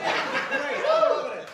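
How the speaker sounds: high and loud with laughter; delighted laugh. Real, not acted. Host gushing about the story while laughing. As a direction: gush with glee.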